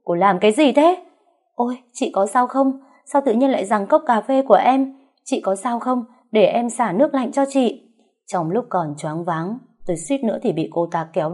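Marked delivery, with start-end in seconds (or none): 0.0-1.0 sudden loud cry, pitched up; blurting out a shout. A narrator's reading. Scalded woman cries out at her attacker, loud. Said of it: "buột miệng kêu toáng lên". 1.5-7.8 put-on higher, sugary, overly sweet young woman; fake concern. The mistress feigns worry for the scalded wife, higher and sweet.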